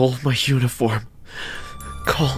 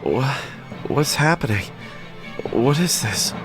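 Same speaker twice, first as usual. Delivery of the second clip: groggy, higher halting questions; dazed, confused, broken questions; dizzy voice; 5 semitones higher than usual. A narrator's reading. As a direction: mumble as if just coming to. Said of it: "said in a dizzy voice".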